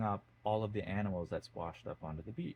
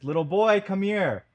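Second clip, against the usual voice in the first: put-on much higher and louder, an angry old man's call; 11 semitones higher, 12 dB louder than usual. A narrator's reading. Old man calls the ignoring boy again, louder and angry. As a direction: raise the voice now, clearly angry. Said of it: "gets a little bit louder because he's very angry".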